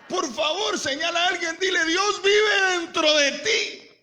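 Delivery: high, shouted proclamation; preacher's shout. Acted. Preacher telling people to point at someone and proclaim, high shout. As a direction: cry it out high, stretching the final words.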